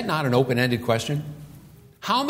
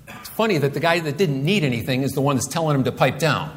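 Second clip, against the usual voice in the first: ordinary talk, nothing marked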